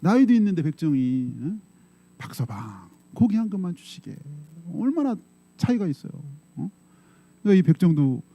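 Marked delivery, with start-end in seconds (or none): 2.2-4.1 polite request. Retold or imitated. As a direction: ask gently and respectfully. Polite nobleman asks butcher. (부드럽고 공손하게 부탁하는 양반)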